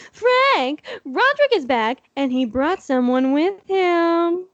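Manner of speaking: high, haughty posh call upstairs; snooty rich man. Acted. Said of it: "sound like a snooty rich man".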